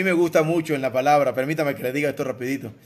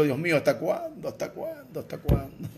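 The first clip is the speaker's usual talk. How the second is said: psalmist's lament fading; 6 dB quieter than usual. Retold or imitated. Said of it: "como el salmista".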